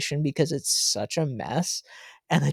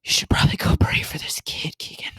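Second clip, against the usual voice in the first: hushed, breathy whisper from the pastor; retold whisper. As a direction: whisper it as if not to disturb the room. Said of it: "and he's like".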